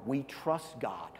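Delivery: slow, deliberate "we trust God"; slow and measured; slow emphasis. Acted. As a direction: let each word land.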